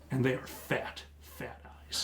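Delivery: low hushed creepy reveal. A narrator's reading. GM revealing eyes in the window, hushed and creepy. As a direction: drop to a near whisper.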